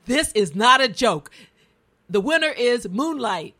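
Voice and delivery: loud, firm corrective announcement; retold stage announcement. Host retells someone correcting the Oscar winner, loud mock announcement. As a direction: declare it forcefully to the whole hall. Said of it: "somebody came on and said".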